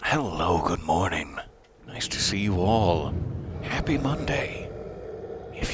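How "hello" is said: ordinary talk, nothing marked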